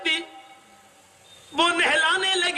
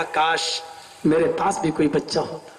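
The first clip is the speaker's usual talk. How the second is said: lower, quick wistful wish; longing father; 11 semitones lower than usual. Acted. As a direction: sigh it out as a yearning father. Father wishes for a child. (باپ کی حسرت بھری خواہش، نیچی آواز)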